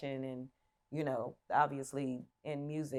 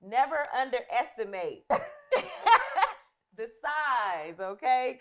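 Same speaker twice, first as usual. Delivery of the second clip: louder and higher, laughing through; laughing boast; 8 semitones higher, 6 dB louder, slower than usual. Real, not acted. Host bragging to interviewer, laughing slowly. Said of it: "boasting about her cooking".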